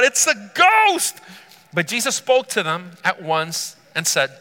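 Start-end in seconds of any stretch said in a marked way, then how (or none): 0.0-1.2 high, loud, drawn-out cry of alarm; fearful cry. Acted. Disciples cry out at seeing Jesus on the water, fearful shout. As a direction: cry it out in terror, stretching the words. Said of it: "In their fear, they cried out".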